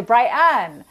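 "Brighton" with a glottal stop for T; glottalized T. Shown as a demonstration. Expert giving the under-thirty version, "Brighton" with dropped T. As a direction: say "Brighton" as Bry-catch-un, younger Utah style. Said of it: "if you're under thirty".

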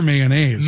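"mayonnaise" with its last syllable leaned on; imitated caller pronunciation. Host telling caller how he says it, stressed third syllable of "mayonnaise". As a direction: tease him by stressing "naise" in "mayonnaise". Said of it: "He got the accent on that third syllable".

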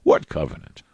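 low, mock-clueless mumbled question; clueless Christians. Preacher imitating what we would say to God, mock-clueless. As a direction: drop the voice low like a baffled believer. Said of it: "that's what we would say".

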